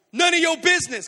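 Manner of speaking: loud, high snapped comeback; acted angry retort. Large woman looking down at intruder, angry retort. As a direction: shout it down at him.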